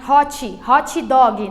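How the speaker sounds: "Hot, hot dog" as "hotchee, hotchee doggy"; added final I. Shown as a demonstration. Teacher mocks learners' habit, "hot dog" as "hotchee doggy". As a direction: Brazilian vowels tacked onto each word of "hot dog". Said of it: "Nossa, é muito feio".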